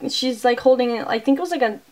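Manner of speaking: normal talking voice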